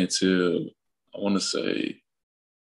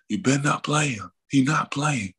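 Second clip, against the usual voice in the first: raised, higher call from far off; dad yelling from the stands; 7 semitones higher than usual. Retold or imitated. Dad calling out to the coach from the stands, raised and higher. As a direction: call out from the bleachers that he's benched. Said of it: "you just hear him in the background".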